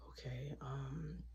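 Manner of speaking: slow unvoiced okay um; whispered hesitation. Teen whispering hesitantly to crying girl. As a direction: trail off in a whisper. Hushed awkward reply.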